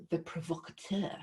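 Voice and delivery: very French pronunciation of provocateur; French accent. Shown as a demonstration. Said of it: "in a very French accent".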